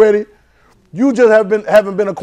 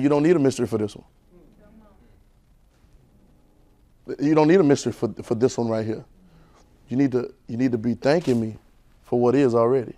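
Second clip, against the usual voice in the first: quiet voice of God; 8 semitones lower, 10 dB quieter than usual. Retold or imitated. God's words voiced in story.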